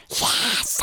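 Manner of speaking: unvoiced, breathy ghost whisper. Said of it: "With the breath".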